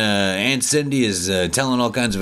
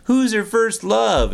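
high, slow mock quizmaster; 12 semitones higher, slower than usual. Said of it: "then she's like".

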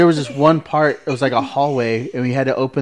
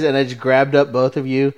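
usual speaking voice

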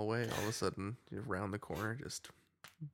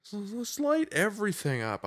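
mocking the cinematographer; 10 semitones higher, 5 dB louder than usual. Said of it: "your idiot cinematographer is just like".

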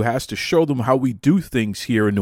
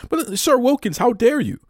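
much higher, hammed-up outrage; mock indignation; 11 semitones higher than usual. Host answering a friend who told him to hit the gym, mock indignant. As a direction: pretend to be deeply offended.

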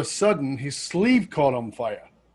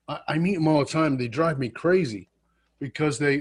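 ordinary talk

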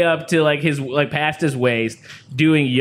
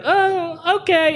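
put-on high deflated kid voice; 14 semitones higher, much slower than usual. Kid's disappointed reply.

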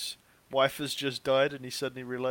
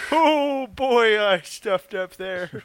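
high, loud mock dismay; hammed-up dismay; 8 semitones higher, 10 dB louder, much slower than usual. Host laughing at his own intro mistake, hammed-up high dismay. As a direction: overplay the oops.

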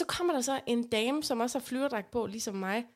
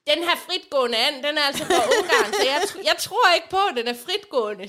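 louder mock call across the shop; a half-laughing raised old lady voice; retold shouting; 4 semitones higher, 5 dB louder than usual. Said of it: "Og så råber hun til mig".

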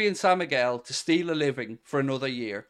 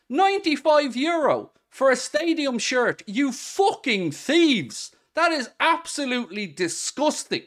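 furious; 7 semitones higher than usual. Real, not acted. Raging at overpriced club kits.

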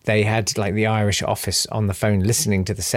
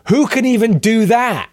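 high, drawn-out incredulous question; slow, high, hammed-up disbelief; mock exasperation; 10 semitones higher, 3 dB louder than usual. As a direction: protest the impossible task, pitched way up. Retelling own exasperation at accent.

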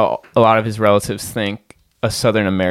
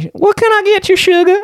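very high, loud Southern sweetheart voice; Southern accent; 6 dB louder than usual. Acted. Southern woman serving a smitten customer, high loud Southern accent. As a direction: squeal it like a hammed-up Southern waitress. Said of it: "when you're in the South".

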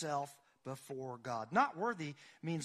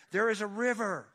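preaching at full force; 5 semitones higher, 9 dB louder than usual. Acted. Pastor proclaiming the psalm to the congregation at full force. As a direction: thunder the verse out over the pews.

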